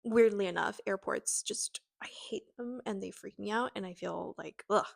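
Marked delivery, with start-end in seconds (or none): 0.9-2.8 hushed, breathy confession; whisper. Real, not acted. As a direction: whisper it like a secret you're ashamed of. Confessing hatred of airports.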